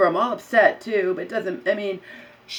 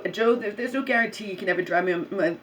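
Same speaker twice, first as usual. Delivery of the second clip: normal talking voice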